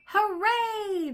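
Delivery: high, loud joyful bark; excited cheer. Acted. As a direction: cheer like happy pups. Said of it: "they barked".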